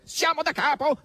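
very high, exasperated outburst; nearly furious. A narrator's reading. Asasello rounds on Margherita, almost furious. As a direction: erupt in high-pitched indignation. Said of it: "si infuriò quasi Asasello".

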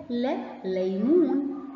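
لا ليمون said slowly, لا stretched and stressed; slow sound drill. Shown as a demonstration. Teacher sounding out لا ليمون slowly for children. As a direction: draw out لا before ليمون, slowly.

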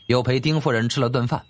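ordinary delivery, nothing marked

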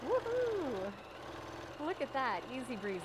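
high, loud woohoo; long, loud whoop of delight; celebratory cheer. Real, not acted. Cheers at finished step.

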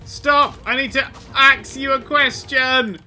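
high, loud mock plea; calling out. Player calls after the hunted parasaur, high loud shout. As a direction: yell after someone running off.

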